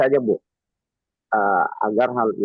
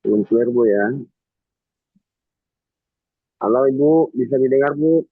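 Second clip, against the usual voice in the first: echoed phrase repeats; 3 semitones higher than usual. Real, not acted. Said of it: "Masih menggema".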